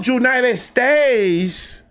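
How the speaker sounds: put-on high, drawn-out Dominican-accented "United States"; impressed coo in a Dominican accent; imitated Dominican accent. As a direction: imitate a Dominican girl, stretching each word slowly. Imitating Dominican girl's reply.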